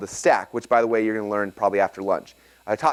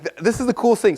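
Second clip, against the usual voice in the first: high-pitched, thrilled gushing; retold excitement, voice jumping up; freaking out; 10 semitones higher than usual. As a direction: gush as if you've just made a discovery. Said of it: "I'm freaking out".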